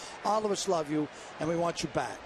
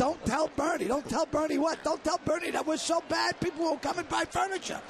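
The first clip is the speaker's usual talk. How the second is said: a lightly voiced kid from the ad, higher; commercial kids; 7 semitones higher than usual. Mocking the kids in the Jordan's ads, higher voice. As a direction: parody the kids in the furniture commercial, pitched up. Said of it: "now they get those kids".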